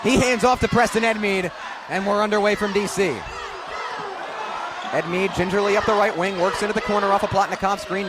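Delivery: normal talking voice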